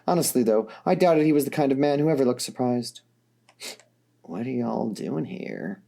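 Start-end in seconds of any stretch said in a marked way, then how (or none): 4.2-5.8 put-on Dirk, softer and slower, with a southern accent; slow, tired southern accent; tired southern drawl. A narrator's reading. Dirk, surprised by visitors at night, tired southern accent. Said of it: "a bit of a southern accent".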